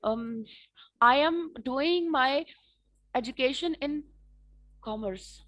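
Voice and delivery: slow, stalling, um-filled answer; halting with pauses. Shown as a demonstration. Student nervously answering the examiner, slow and halting. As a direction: stretch the words as if thinking hard. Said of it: "थोड़ा सा आप pause ले लेते हो".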